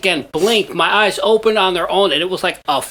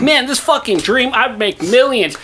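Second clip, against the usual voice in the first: higher-pitched, thrilled retelling; eager mock self-quote, pitched up; acted excitement; 7 semitones higher than usual. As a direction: act out waking with a million-dollar idea. Acts out waking excited by dream.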